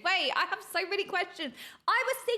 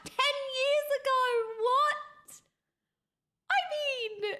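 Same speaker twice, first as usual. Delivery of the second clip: astonished; 8 semitones higher, slower than usual. Real, not acted. Streamer learning the series is years old, astonished. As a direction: let the voice jump up in surprise.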